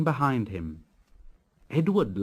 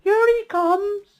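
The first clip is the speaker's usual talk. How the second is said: high excited shout; boy shouting; 7 dB louder than usual. Acted. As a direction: call it out loud and high like a thrilled little boy. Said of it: "a little boy shouted".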